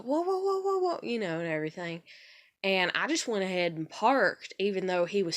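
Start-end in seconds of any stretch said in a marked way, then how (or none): 0.0-1.0 high mock alarmed whoas; husband freaking out in the car; imitating panicked whoa. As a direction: mimic a jumpy passenger. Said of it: "he was like".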